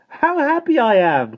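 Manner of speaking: high, loud, slow exclamation falling at the end; happy cry. A narrator's reading. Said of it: "cried Jane".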